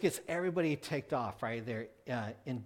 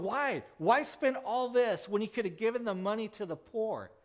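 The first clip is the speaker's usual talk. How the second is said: higher, complaining protest; indignant disciples; 7 semitones higher than usual. Retold or imitated. Disciples complain to Mary about the poor, mildly raised indignant voice. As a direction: press the why like grumbling disciples. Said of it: "They're going".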